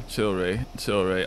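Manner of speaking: ordinary talk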